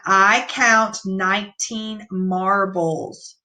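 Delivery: calm slow reread. Shown as a demonstration. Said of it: "Let's read it one more time".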